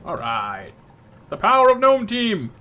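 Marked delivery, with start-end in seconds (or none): 1.3-2.5 high, loud team chant; mock-heroic shout; rallying cry. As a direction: proclaim it like a cartoon hero. Playful team battle cry.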